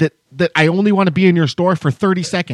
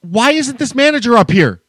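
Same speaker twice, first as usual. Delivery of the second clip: higher and louder, fuming; furious thought about the missing manager; angry inner rant; 9 semitones higher, 3 dB louder than usual. Retold or imitated. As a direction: demand the manager show up. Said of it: "all I could think about was".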